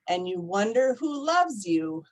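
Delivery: ordinary delivery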